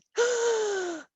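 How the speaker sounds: loud high gasp of fright; scared gasp. A narrator's reading. Narrator acting the mice seeing the cat, scared gasp. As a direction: gasp in fear.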